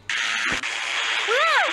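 high-pitched "Ah!"; startled cry. Pretend. Player startled in the game, high cry. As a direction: let out a high yelp.

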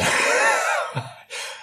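silent high wheeze of laughter; high wheezing laugh. Real, not acted. Teller laughs at story.

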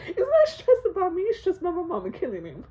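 usual speaking voice

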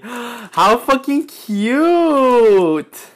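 loud high cry, very drawn out; gasp, then stretch the squeal; delighted squeal. Real, not acted. Delighted by gift.

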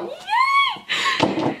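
very high, squealed cheer; gleeful cheer. Real, not acted. Vlogger cheering a gift perfect for fall, gleeful squeal. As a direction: squeal with glee.

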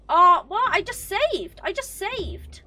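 high outburst, falling at each end; shocked high-pitched complaint; indignant disbelief. Real, not acted. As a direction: protest it in a high, wailing voice. Protests losing saved progress.

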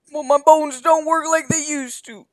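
put-on high, strained old man voice; creaky grandpa complaining about his bones. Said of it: "I really need to work on my old man voice".

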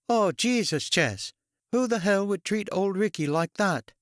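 high-pitched Kennedy-esque Boston woman; Kennedy-style Boston accent, pitched high; Bostonian twang. A narrator's reading. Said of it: "Carol asked in a Kennedy-esque Bostonian twang".

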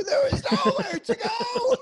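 high, drawn-out imitated wail; mock breakdown shout. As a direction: yell it like a man having a meltdown. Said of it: "video of you having a breakdown".

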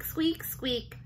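ordinary delivery

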